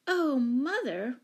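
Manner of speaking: put-on slow, high child's wail; plaintive little-girl lament. A narrator's reading. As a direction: draw it out in dismay. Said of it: "wailed Betsy".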